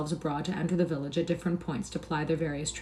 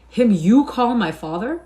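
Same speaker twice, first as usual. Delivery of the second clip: incredulous; 5 semitones higher, 8 dB louder, slower than usual. A narrator's reading. Hugo incredulous, louder.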